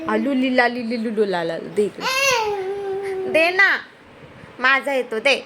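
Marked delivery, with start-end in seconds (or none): 0.1-1.6 lightly voiced baby babble. Parent teasing the toddler with a light baby babble. 1.8-3.7 high, drawn-out mock crying plea; mock whine. Parent mimicking the toddler begging for the rubber band, mock crying whine.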